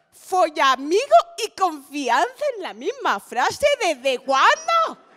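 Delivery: loud, slow, swooping disbelief; hammed-up incredulous challenge, drawn out; mock outrage. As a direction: stretch out the words in comic indignation. Acts outrage at the phrase.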